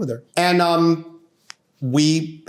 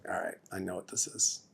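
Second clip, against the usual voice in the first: much softer and lower, as if to himself; low resigned aside; 6 semitones lower, 16 dB quieter than usual. Retold or imitated. Lawyer hearing his client is upset, quiet resignation.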